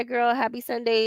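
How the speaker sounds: ordinary talk, nothing marked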